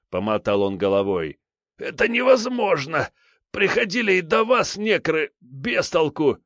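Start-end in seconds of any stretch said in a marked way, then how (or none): 1.8-6.4 high whiny frightened thug; a cornered petty crook pleading; scared thug voice. A narrator's reading.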